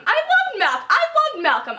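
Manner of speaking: high, loud fangirl squeal; excited gushing. Real, not acted. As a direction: squeal it out with love, voice leaping up. Gushing over a favourite character.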